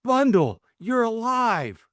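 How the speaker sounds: high, loud cry of disbelief; amazed gasp. Acted. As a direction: gasp it out, stunned to find her alive. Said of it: "gave a gasp of utter amazement".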